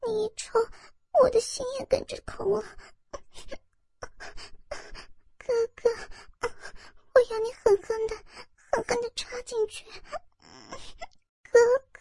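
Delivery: high, tearful, begging lover; whimpering girlish plea, soft; pleading young woman. Acted. Narrator voices the woman pleading. (带哭腔的娇声哀求)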